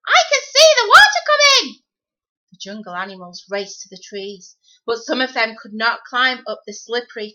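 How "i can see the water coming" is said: very high, loud squawking bird voice; squawking cockatoo. Acted. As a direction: screech the warning like a parrot. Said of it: "squawked the cockatoo".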